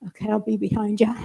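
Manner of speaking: quick, soft words through a laugh; nervous laugh. Retold or imitated. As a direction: laugh nervously while answering. Nervous grandma answering grandson.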